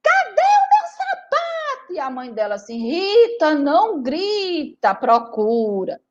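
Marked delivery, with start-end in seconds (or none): 0.0-1.9 high drawn-out yell at mom; child yelling. Acted. Rita yelling to her mother about her shoe, high and loud. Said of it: "ela já saía logo gritando". 2.8-6.0 slow, patient mother's reply; calm mother. Acted. Mother patiently telling Rita to look, slow and even.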